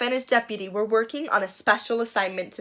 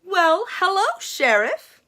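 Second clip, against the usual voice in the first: Missus Flaherty; 9 semitones higher, 3 dB louder than usual. Acted. Missus Flaherty greets sheriff.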